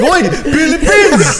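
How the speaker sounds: slow, high, laughing cry; excited surprise. Retold or imitated. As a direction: stretch out the shock with a laugh. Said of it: "I was like".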